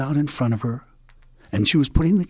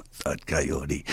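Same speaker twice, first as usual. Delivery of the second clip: plain reading voice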